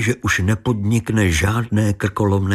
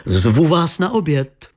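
calling out; 7 semitones higher than usual. A narrator's reading. Věra calls from window.

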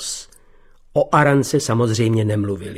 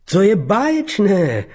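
high, slow, hollow delight; insincere; 8 semitones higher than usual. A narrator's reading. He greets Beata, insincerely, high and slow. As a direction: fake the enthusiasm, dragging it out. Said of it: "pronesl neúpřímně".

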